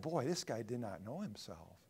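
ordinary talk, nothing marked

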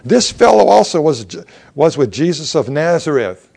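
high, loud pointed accusation; accusing girl. Acted. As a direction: call him out in front of everyone. Servant girl accusing Peter aloud.

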